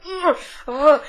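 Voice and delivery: high-pitched effortful "ugh"; mock struggle grunts; imitated straining grunts. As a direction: grunt as if holding back a heavy weight. Imitates Obi-Wan straining at window.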